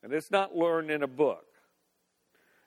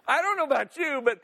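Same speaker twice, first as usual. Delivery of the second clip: higher, still laughing through the words; laughing aside; 7 semitones higher, faster than usual. Real, not acted. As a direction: chuckle it to the crowd.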